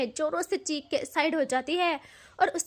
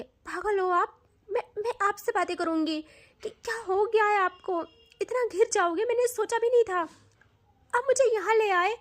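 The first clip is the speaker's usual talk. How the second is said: high, rising, upset accusation; mild angry accusation; 6 semitones higher than usual. Acted. Siya accuses Tanu of bringing her here, high and upset. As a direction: pitch climbs higher as she accuses.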